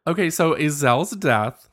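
normal talking voice

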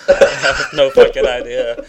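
laughing. Real, not acted. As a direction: laugh it out, baffled. Laughing at own confusion.

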